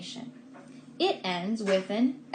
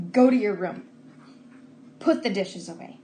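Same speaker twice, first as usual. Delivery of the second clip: put-on firm, bossy order to a child; bossy command; 2 semitones lower, 5 dB louder than usual. Shown as a demonstration. Teacher acting out a bossy parent's command. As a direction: boss them around. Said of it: "So a command is bossy".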